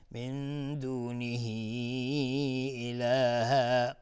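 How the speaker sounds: "من دونه" slow, final hi stretched very long before "اله"; long madd silah. Shown as a demonstration. Teacher shows the great madd, "من دونه اله" with long-held hii.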